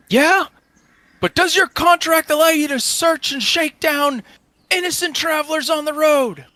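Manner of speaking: high, loud indignant challenge; yelled accusing question; shouted retort. Acted. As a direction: argue back across a distance at full voice. Morgan arguing across the road.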